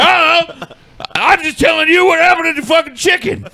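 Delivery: put-on high drunk excuse. Scotty explaining the chicken story to Paul, high drunk protest. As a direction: play the drunk friend shrugging it off. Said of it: "He's like".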